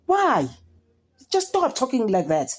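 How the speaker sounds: high, pleading why; high exasperated plea. Retold or imitated. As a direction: beg him to stop, exasperated. Her own reaction quoted.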